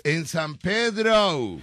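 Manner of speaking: San Pedro drawn out as "Pedrou" in English accent; mock English. Shown as a demonstration. Host teaching Eva English San Pedro, exaggerated English accent. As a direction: say Pedro slowly with a gringo "ou" ending. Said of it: "En inglés es".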